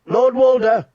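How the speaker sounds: high loud call across the hall; shouting. Acted. Catelyn shouting to Lord Walder across the hall. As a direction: shout his name over the noise. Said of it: "she shouted".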